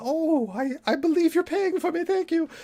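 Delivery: high squeaky mock of Penny; mock falsetto. Podcaster mocking Penny's scam, squeaky high falsetto. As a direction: parody her fake gratitude, way up high.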